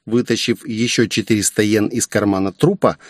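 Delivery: ordinary delivery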